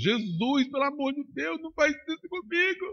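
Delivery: rising, pleading, a touch of sobbing; preacher recalling begging Jesus while giving up his Isaac; retold tearful plea. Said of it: "Fiz chorando, debulhando em lágrimas".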